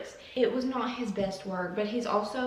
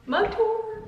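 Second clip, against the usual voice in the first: much higher, louder burst; excited exclaim; 13 semitones higher, 5 dB louder than usual. Real, not acted. Announcing a mug tour to viewers, with excited high exclaim. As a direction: shout it like a show title.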